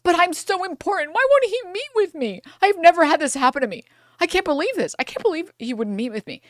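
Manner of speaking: high, whiny self-pity; mock the rich guy stunned he was snubbed; mock wounded ego. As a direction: whine like an offended big shot. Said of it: "to be like".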